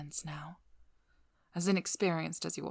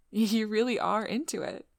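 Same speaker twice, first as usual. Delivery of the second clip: higher, louder playful gush; bubbly, pitched-up amazement; amused delight; 4 semitones higher, 8 dB louder than usual. Acted. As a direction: tease your date, delighted. Teasing delight at the decorations.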